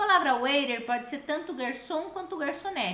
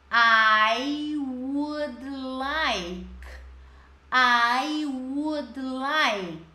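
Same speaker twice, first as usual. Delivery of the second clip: "I would like" stretched very slowly, syllable by syllable; slow model phrase; much slower than usual. Shown as a demonstration. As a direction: drag out each word for the class to copy. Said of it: "reproduz bem igualzinho a teacher tá fazendo". ("I would like" bem lento e pausado)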